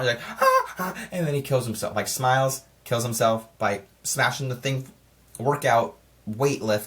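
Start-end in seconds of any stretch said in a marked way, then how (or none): 0.4-1.1 high, panicked gasps imitated; mock freaking out. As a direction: gasp high and loose, like someone freaking out. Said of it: "he's freaking out".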